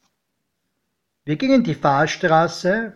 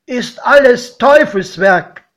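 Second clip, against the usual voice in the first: put-on raised, louder skeptical old farmer; godfather's grumble; 7 semitones higher, 8 dB louder than usual. A narrator's reading. Godfather dismissing the new railway, loud and scornful. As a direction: scoff like a suspicious countryman. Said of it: "meinte er".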